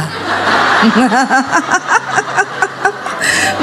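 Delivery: long airy laugh at her own joke; breathy chuckle after the punchline; laughter. Real, not acted.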